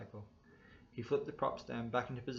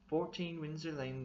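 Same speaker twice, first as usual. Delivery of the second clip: oily passenger; 6 semitones higher than usual. A narrator's reading. Passenger gives address.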